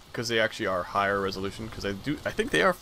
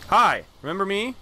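taunting greeting; 8 semitones higher, 8 dB louder than usual. Pretend. Greets enemy before attacking.

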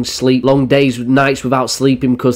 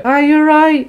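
put-on sweet high greeting to the elderly; 14 semitones higher than usual. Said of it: "I literally look at them and go".

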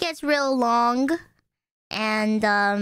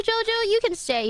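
pet talk; 9 semitones higher than usual. Real, not acted. Softening toward the cat.

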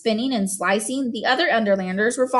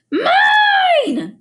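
high, loud piercing scream; toddler scream; 6 dB louder than usual. A narrator's reading. Boots screaming as Gregor eats her cookie, piercing scream. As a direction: shriek it like a furious toddler. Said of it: "screamed Boots".

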